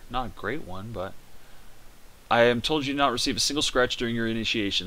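usual speaking voice

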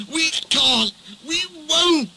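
high, strained whining refusal; stubborn trucks. A narrator's reading. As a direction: whine it like old trucks that refuse to budge. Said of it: "they screamed".

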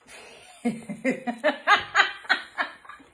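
high helpless laugh; cracking up at herself; real laughter; burst of laughter. Real, not acted. Laughing at tight jacket.

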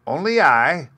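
little gentleman at midnight; eerie visitor voice. A narrator's reading. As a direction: announce yourself from the dark.

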